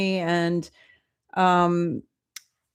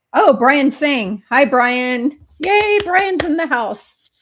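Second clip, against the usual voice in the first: excited greeting; 6 semitones higher, 6 dB louder, slower than usual. Real, not acted. Friend Brian joins the stream.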